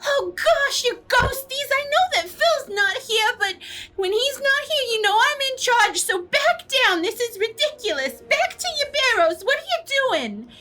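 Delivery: very high squeaky scolding voice; tiny, high-pitched bossy cry; Adela calling out. Acted. Adela ordering the ghosts back to their barrows, shrill calling out. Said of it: "she calls out".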